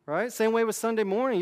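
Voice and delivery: normal talking voice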